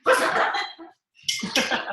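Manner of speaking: high, loud mock impatience calling a friend; hammed-up begging for a reply; impatient pleading. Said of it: "I'm like".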